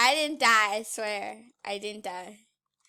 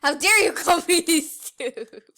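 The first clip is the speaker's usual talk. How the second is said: mock outraged voice; 4 semitones higher, 10 dB louder than usual. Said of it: "in that voice?".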